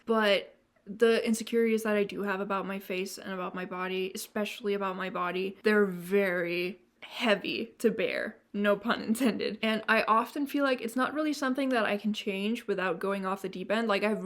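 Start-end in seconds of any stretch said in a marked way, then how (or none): none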